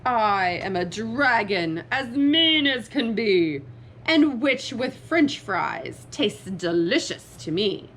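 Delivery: mean, menacing dragon voice; scary dragon roar. Acted. Dragon threatening to eat the witch, scary roar. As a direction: boom it out like a hungry dragon. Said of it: "a roar that was scary and loud".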